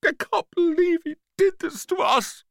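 tiny high-pitched tearful voice; squeaky and close to crying; fighting back tears. A narrator's reading. Hurt pea complains to the other peas, tearful. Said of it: "fighting back the tears".

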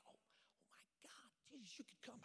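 very soft, acted hushed gasp of prayer; barely voiced, as if overawed by the sky; awed hush. Boy praying at the sight of the clouds, awed hush.

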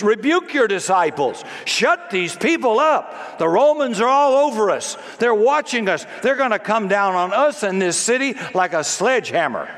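high, urgent demand; alarmed Pharisees. Acted. Religious leaders begging Jesus to silence the crowd, alarmed demand.

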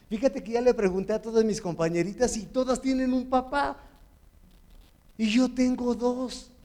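a hint of a child proudly sharing news; young daughter's voice. A girl of ten cheerfully telling her father she has two dads, in a light child's voice. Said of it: "me dijo".

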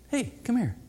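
gentle, quiet hey come here; hushed friendly beckon; soft call. Shown as a demonstration. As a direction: call a friend over softly. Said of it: "there's a little different than saying that".

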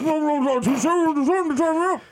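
talking through a taped mouth; muffled. Pretend. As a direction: mumble through a gag. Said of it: "tied up with Gorilla Glue tape around his mouth".

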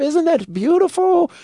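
high, slow, wondering Michael voice; Michael asks his assistant, in a high slow coo; Michael impression. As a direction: coo it like Michael admiring the chain. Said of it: "he turns to his assistant".

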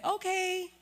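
a loud, high, startled okay; stunned high okay. Retold or imitated. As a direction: say yes while in shock. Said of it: "So I said".

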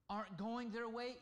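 ordinary talk, nothing marked

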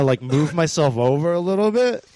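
usual speaking voice

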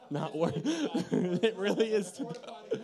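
words pushed out through laughter; laughing while talking. Real, not acted. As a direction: crack up mid-sentence. Joking about lactose intolerance and cheesecake.

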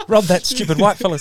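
high, mocking robber voice; a gleeful pretend robber; imagined robbers choosing a target, mocking and high.

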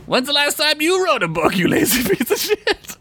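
very high, cackly mock old-timer; Samuel Clemens. Clemens taunting the podcaster about writing a book, high mock voice. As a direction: needle them in a squeaky codger voice. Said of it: "Mister Clemens".

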